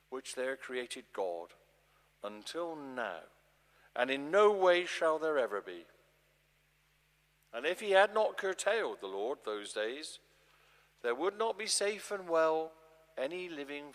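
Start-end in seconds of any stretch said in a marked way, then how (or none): none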